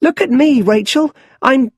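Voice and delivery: put-on high, loud, gleeful child calling out; a small boy showing off on the well, loud and high; little Bruce calls to Rachel from the well, high and loud with glee; excited little boy. A narrator's reading. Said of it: "climbs onto the well and laughs".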